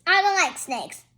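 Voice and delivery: plain reading voice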